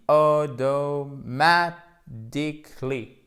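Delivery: slow, stretched AUTOMATICALLY, syllable by syllable; découpé en syllabes. Shown as a demonstration. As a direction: draw out every syllable of "automatically". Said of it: "avec un petit accent tonique". (automatically très lent, syllabe par syllabe)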